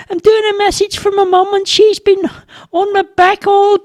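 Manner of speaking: put-on very high, squeaky child voice; flustered little boy. A narrator's reading. Boy explaining he is in trouble with mum, flustered. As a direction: pipe it out, sorry for yourself.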